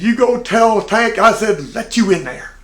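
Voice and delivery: the boss sending him back with authority; mock order.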